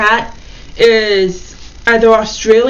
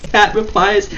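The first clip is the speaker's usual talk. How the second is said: ordinary talk, nothing marked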